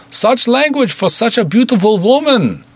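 put-on a Russian goon bargaining in thick Russian accent; heavy Russian accent. A narrator's reading. Russian goon calling through the door, heavy Russian accent.

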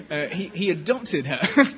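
words through laughter; laughing. Real, not acted. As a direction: laugh while getting it out. Laughing after own slip.

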